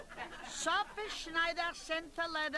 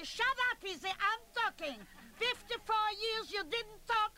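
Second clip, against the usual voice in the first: snapping at husband; 8 semitones higher than usual. Acted. Wife shuts up her husband at the meeting, snapping. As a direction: tell the silent husband off.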